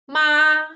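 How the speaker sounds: "Ma" high, level and loud; first tone. Shown as a demonstration. Students listen with eyes closed, "Ma" high and level. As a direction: hold "Ma" long and steady up high. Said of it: "they are different".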